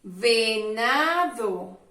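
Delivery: Venado said very slowly, syllables drawn out; slow model word. Shown as a demonstration. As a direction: stretch Venado out so children hear the syllables. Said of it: "Qué sílaba de la V".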